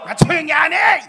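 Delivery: sudden high, very loud rebuke; angry shout. Acted. Preacher playing the crowd silencing worshippers, explosive angry shout. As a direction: yell them down furiously.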